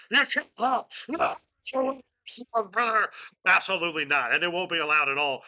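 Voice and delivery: loud, high wrestling-promo yell, brother; Hulk Hogan. Acted. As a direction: bellow it like a pro wrestler cutting a promo. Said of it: "I can do a very good Hogan".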